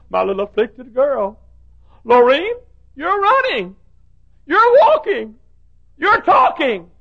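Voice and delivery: slow start building to high, loud cries; joyful mother. Retold or imitated. Mother finding her afflicted daughter healed in heaven, joyful cries. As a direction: rejoice at seeing her healed.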